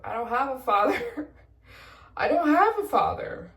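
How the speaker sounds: high, loud, tearful laugh through the words; laughing near tears. Retold or imitated. Applicant telling officials about no father, laughing near tears.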